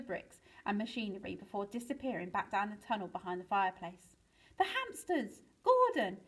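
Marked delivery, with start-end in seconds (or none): 4.6-6.2 high-pitched exclamations of alarm; alarmed realisation. A narrator's reading. Mister Penguin remembers the forgotten captives, alarmed. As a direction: cry out as you suddenly remember.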